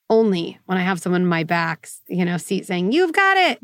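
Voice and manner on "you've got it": high-pitched, peppy encouragement; mock cheerleading. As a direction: play an eager backseat cheerleader, pitched high. Backseat cheerleader voiced.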